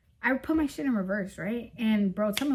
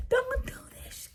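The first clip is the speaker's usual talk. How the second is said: high, begging whine imitating him; mock his desperate plea; imitating a clingy boyfriend begging; pleading boyfriend; 14 semitones higher, 3 dB quieter than usual. Boyfriend pleading her not to leave.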